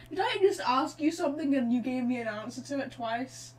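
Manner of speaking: normal talking voice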